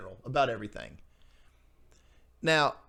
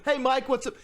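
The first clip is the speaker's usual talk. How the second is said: mock greeting; 9 semitones higher than usual. Imitates teen on 90s tape.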